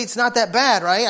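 usual speaking voice

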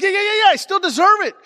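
put-on high, loud retort of a pleading boy; insistent kid. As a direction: imitate a kid loudly protesting, pitch way up. Boy insisting to his mom.

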